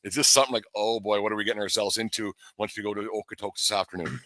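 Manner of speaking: ordinary talk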